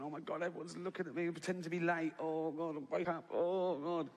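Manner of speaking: hurried, embarrassed inner voice; a touch of hammed-up dismay; mock horrified. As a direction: play at being horrified by the attention. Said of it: "just sort of horrified".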